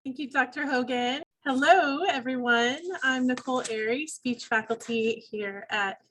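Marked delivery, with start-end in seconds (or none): none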